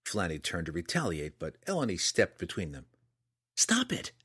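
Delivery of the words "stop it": very high-pitched outcry; sharp cry. A narrator's reading. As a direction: cry out to break up the fight. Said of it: "Eleni cried, so loudly".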